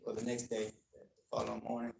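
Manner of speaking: usual speaking voice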